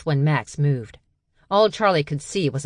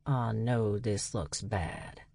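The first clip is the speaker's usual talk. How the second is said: much softer and slow, almost mumbled; quiet, sheepish; 5 semitones lower, 9 dB quieter, slower than usual. A narrator's reading. Dutch owning up to the sisters, hushed and slow. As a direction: sheepishly admit it, low and hushed.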